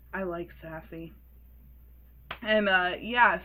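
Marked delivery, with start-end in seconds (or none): 0.1-1.1 low, soft, deadpan creep; creepy tone. Retold or imitated. Mechanic leaning in about a sassy customer, soft and creepy. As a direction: lean in low and quiet, creepily. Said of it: "it's just so creepy".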